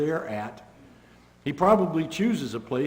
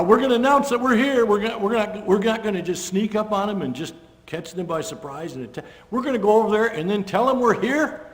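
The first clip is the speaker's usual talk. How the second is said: higher and louder, hammed-up soldier; mock announcement; 7 semitones higher, 6 dB louder than usual. Preacher voicing soldiers who plan to announce themselves, loud and mocking. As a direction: play it like a naive boaster.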